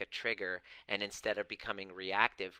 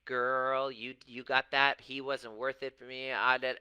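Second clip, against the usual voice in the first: louder, higher, hammed-up sassy gossip; mock gossiping girlfriend; 4 semitones higher, 7 dB louder than usual. Mocking support-group members who validate each other, loud sassy gossip. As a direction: ham up a sassy friend egging her on. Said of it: "men and women, saying".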